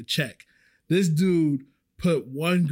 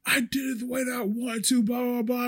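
higher, heated mock yell; retold yelling; 8 semitones higher than usual. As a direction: throw it back like a boss who won't budge. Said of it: "he just starts yelling at me".

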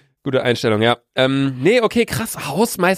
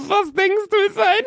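laughing. Real, not acted. Giggling fit over job titles.

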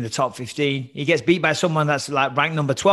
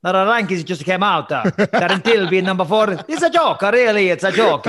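put-on hammy Italian accent, pitched higher; Marvin Vettori Italian accent; 4 semitones higher, 5 dB louder than usual. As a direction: play up a heavy Italian accent. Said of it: "I'll do it as Marvin Vettori".

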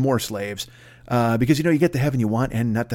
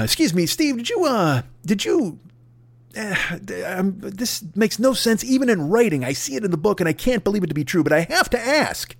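higher-pitched, hesitant official; awkward, reluctant questioning; 6 semitones higher than usual. Acted. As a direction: stall before an embarrassing question. Gatekeeper reluctantly asks absurd question.

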